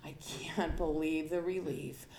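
soft, hushed relief after the first beer; relieved sigh. Retold or imitated. Teen with her first beer, soft relieved hush. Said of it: "and it's like".

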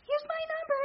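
put-on gushing teen girl. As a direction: chirp it like an eager nineteen-year-old. Voicing the teenage girls crowding her.

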